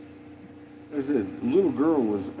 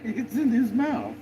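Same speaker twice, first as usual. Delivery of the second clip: excited retelling; 12 semitones higher than usual. Real, not acted. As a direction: tell it wide-eyed and amused. Retelling dog's stubborn tussle.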